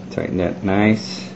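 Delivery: usual speaking voice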